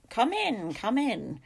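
put-on high, imperious old lady's call; harsh shout. A narrator's reading. Lady Agnes shouting from the other side, harsh. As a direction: call out harshly through a door. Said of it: "The voice was so harsh".

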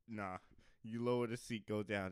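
ordinary talk

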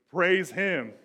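loud, high shout of praise; exultant preacher's cry; triumphant praise. Acted. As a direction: proclaim it like a rousing psalm ending. Acts out triumphant psalm ending.

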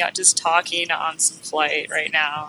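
usual speaking voice

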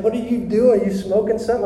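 imitating puzzled coworker. Unbelieving coworker to freshly healed friend, puzzled. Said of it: "He's like".

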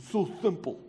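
simple in a playful Bronx accent. Shown as a demonstration. Preacher clowning over the word simple, mock Bronx accent. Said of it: "best Bronx accent right there".

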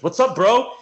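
mock-aggressive, punchy and high; retold confrontation. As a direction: imitate a tough guy squaring up. Retold big guy confronts Bobby.

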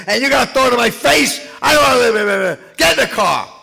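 hammed-up enraged husband shouting; furious husband. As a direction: blow up at her in self-pity. Husband exploding at wife.

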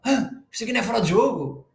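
mock woman in the stands; mocking the woman at the match. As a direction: ape her confident call, a touch mocking. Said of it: "acontece uma merda no meio campo e ela".

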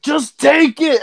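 high-pitched mock yell; frustrated dad. Dad forcing a pill on his kid, retold frustrated yell. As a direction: imitate a dad at the end of his rope. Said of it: "he got so frustrated".

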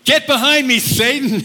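high, sharp rebuke to Satan; stern rebuke. Retold or imitated. Jesus rebukes Peter.

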